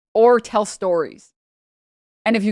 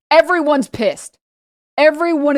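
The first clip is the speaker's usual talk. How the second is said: louder and higher, slowed, stressing pissed; emphatic loud; 6 semitones higher, 6 dB louder than usual. Real, not acted. Telling friends how furious Greeks get, loud and emphatic. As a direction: hammer home how angry they get. Describing everyone getting angry.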